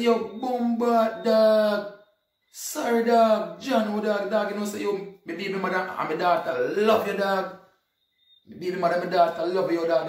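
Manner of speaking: high, loud, rushed excitement; recounting the meeting, loudly excited and high; excited retelling. Retold or imitated. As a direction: gush over the fan like meeting a star.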